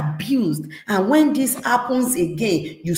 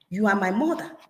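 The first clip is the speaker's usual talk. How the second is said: usual speaking voice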